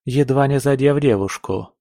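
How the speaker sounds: ordinary delivery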